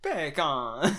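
Jamaican bacon like beer can; Jamaican accent. Shown as a demonstration. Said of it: "in a Jamaican accent".

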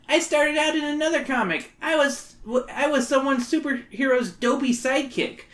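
high, whiny schoolkid; whiny kid voice. Acted. Wally character voice.

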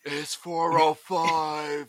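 very slow, heavy Seagal voice; deadpan Seagal, drawn out; Seagal impression. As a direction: stretch every word like Seagal. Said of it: "it was a good impression".

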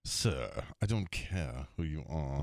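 low, slow English club secretary; English accent. Retold or imitated. English secretary refusing a tour player, low proper English accent. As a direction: dismiss him slowly in proper English tones. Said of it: "This guy was English".

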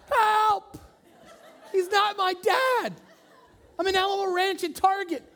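put-on very high, loud kid shout through laughter; hammed-up little girl shouting with laughs; laughing child yelling. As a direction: yell for help while cracking up. Daughter keeps yelling for help.

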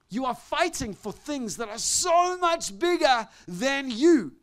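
high, loud, fast preaching shout; full-force preaching. Real, not acted. Preacher driving home his point to the congregation, full-force preaching. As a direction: build and hammer it to the crowd.

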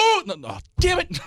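high yelp of dashed hope; comic disappointment. Retold or imitated. Retold false hope at birthday songs.

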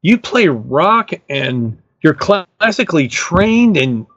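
imitating his friend Dan. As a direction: spell out the argument like a patient friend. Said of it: "he goes".

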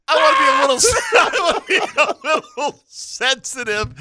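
words breaking up in giggles; laughing hard. Real, not acted. Host teasing cohost, laughing too hard to finish. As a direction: try to speak through real laughter. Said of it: "I'm laughing so hard".

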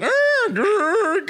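high, loud mock grunting baby; manly baby grunts. Host imagining a baby toy growling manly. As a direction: grunt it out like a gruff little baby. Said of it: "in a quite manly voice going".

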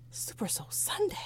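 soft whispered aside like a TV show host. Pretend. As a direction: breathe the show title as a joke. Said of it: "playful show joke".

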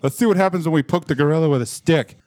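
put-on a bully kid egging his friends on; mocking bully voice. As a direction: voice the little kids daring each other. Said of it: "who I guess just wanted to".

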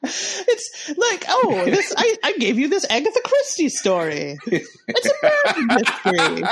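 laughing through a mock quote. Real, not acted. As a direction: break up with real laughter mid-sentence. Laughing while mocking the story.

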